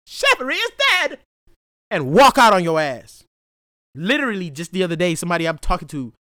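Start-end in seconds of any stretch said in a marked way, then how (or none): 0.1-1.2 high huffy voice; lightly voiced prissy indignation; mock offended. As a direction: play the insulted snob walking out.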